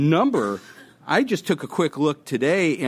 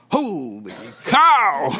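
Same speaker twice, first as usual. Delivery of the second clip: drawn-out, loud gasp of Holy cow, breaking into a laugh; stunned exclamation; 2 semitones higher, 4 dB louder, much slower than usual. Acted. Rider looking over the cliff edge, a slow astonished laughing cry. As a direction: let it out slowly, half laughing at the punchline.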